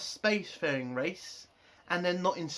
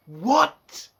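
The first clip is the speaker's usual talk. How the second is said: loud, high shocked "What?"; incredulous what; 8 semitones higher, 11 dB louder than usual. Retold or imitated. Fan reacts to the therapy sentence, loud disbelief. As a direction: blurt it out in total disbelief. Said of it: "And I'm just like".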